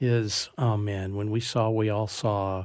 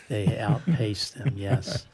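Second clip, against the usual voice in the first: normal talking voice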